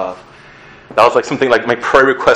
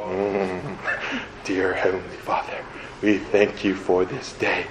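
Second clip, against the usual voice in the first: hushed, mock-solemn rote church prayer; parodied pious monotone; 4 semitones lower, 9 dB quieter than usual. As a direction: murmur it like a bored routine grace. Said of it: "rote prayers you always hear at churches".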